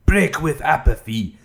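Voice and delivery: slow, portentous put-on voice; Star Wars prequel character. Reading out tips, in a mock Star Wars prequel voice. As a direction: declaim it like a stilted film character. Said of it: "I sound like a S-, Star Wars prequel character".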